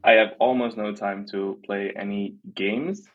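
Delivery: normal talking voice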